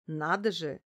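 ordinary delivery

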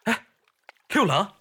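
high, loud call across the water. Real, not acted. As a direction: call his name so he can hear. Calling to him from the boat.

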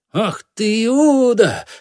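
high, loud outburst with a long-held cry; agitated roar. A narrator's reading. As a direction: roar the accusation in outrage. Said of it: "прорычал страшно взволнованный Гамбет". (яростный, взволнованный крик)

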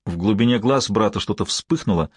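usual speaking voice

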